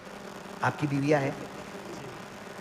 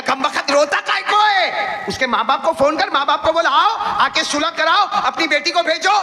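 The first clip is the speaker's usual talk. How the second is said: mock scolding; 10 semitones higher than usual. Preacher scolding the crying husband, playful mock exasperation. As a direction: scold him, half-joking and exasperated.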